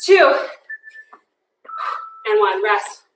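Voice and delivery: panting between words; winded after a hard set; out of breath. Real, not acted. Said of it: "I'm out of breath".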